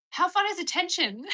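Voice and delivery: high, loud mock delight; imitating a teen thrilled by attention; giddy younger self. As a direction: gush it, hammed-up. Retold thrilled teenage self.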